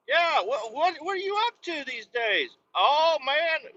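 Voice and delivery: high, strained phone chatter; hammed-up high catch-up voice; mock phone-call yelling. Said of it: "I don't know why I'm yelling".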